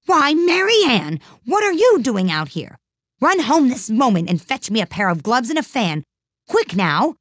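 high-pitched, bossy scolding; angry call. A narrator's reading. Rabbit mistakes Alice for his maid, angry. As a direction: order the servant off angrily. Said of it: "called out to her in an angry tone".

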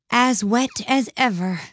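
slow, gloomy and tired; melancholy. A narrator's reading. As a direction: sigh it out, slow and glum. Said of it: "said Alice in a melancholy tone".

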